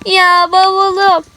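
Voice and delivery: drawn-out crying wail over her lost suitcase; fake sobbing. Girl wailing to her friends about her suitcase, sobbing.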